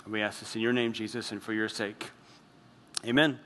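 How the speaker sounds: soft, low-key closing prayer; hushed pastor's prayer voice. Acted. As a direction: pray it gently to God. Closing prayer before sermon.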